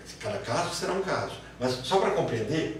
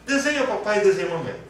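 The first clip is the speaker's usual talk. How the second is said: adult instructing; 6 semitones higher, 6 dB louder than usual. Retold or imitated. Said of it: "fala assim".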